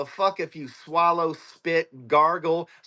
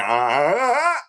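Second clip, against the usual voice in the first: mock strained gasps; 5 dB louder than usual. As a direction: ham up her straining jaw.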